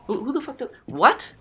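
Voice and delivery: high-pitched, stammering bewilderment; stammer, then a loud high "what?"; baffled disbelief. Retold or imitated. Baffled at finding her topless in pool.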